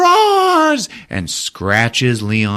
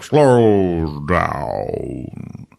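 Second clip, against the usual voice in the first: robot voice; 2 semitones lower than usual. Retold or imitated. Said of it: "the voice".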